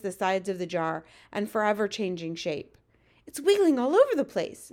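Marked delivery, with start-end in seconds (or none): 3.3-4.7 put-on high, loud girlish exclamation; crying out. A narrator's reading. Girl watches the nightmare thrash, crying out. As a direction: exclaim in excited alarm. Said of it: "Sophie cried".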